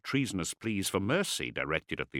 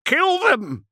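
very high, loud hysterical scream; shrieked command; 8 dB louder than usual. A narrator's reading. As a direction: scream the order in panic. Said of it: "Sark screamed".

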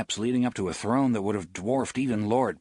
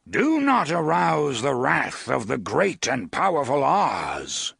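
louder, grand warning; booming warning; 3 semitones higher, 4 dB louder than usual. Acted. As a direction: thunder the threat. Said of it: "the voice cried".

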